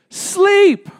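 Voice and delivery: excited answer. Real, not acted. Pastor answers own question to crowd.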